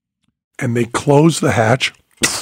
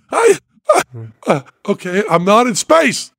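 dazed relief; 7 semitones higher than usual. Retold or imitated. Waking dazed from nightmare.

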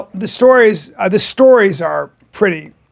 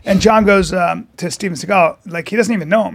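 ordinary delivery

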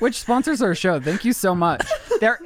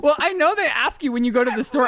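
normal talking voice

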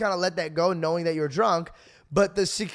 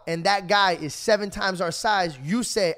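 ordinary talk, nothing marked

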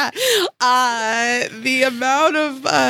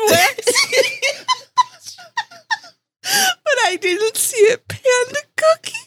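squeaky laugh-crying. Real, not acted. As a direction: push it out through tears of laughter. Lightheaded from laughing.

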